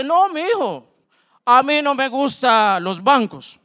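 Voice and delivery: put-on an old man turning down the bank, slowly; old man's voice. Old client answers the salesman.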